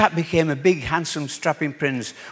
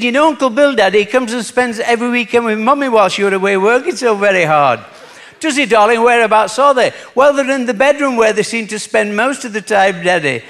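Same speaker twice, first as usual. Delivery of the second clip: put-on a lightly raised little girl's voice on the phone; child voice; 7 semitones higher, 5 dB louder than usual. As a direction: play the small daughter, a touch higher.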